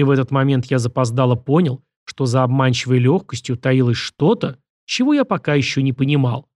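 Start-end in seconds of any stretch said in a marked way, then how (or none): none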